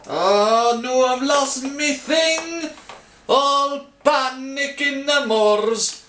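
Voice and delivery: high, drawn-out hammy Scottish wail; mock panic. Baker losing his thing mid-recipe, theatrical mock-panic wail. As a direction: wail it out slowly in pretend alarm.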